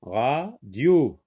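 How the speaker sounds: "Radio" as two separate beats, ra, dio; split into syllables. Shown as a demonstration. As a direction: pause inside "Radio" after ra. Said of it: "Deux syllabes".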